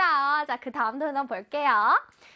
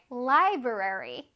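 library, each syllable drawn out slowly; slow syllable drill; much slower than usual. Shown as a demonstration. Teacher modeling library slowly for children. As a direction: stretch out library for the kids. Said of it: "해야 돼요".

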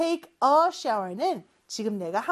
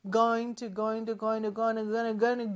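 going to repeated, blurring into gonna; going to sliding into gonna by repetition; speeding up into gonna; 4 dB quieter, much slower than usual. Shown as a demonstration. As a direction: say going to faster and faster until it becomes gonna. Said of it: "빠르게 발음하다 보니까".

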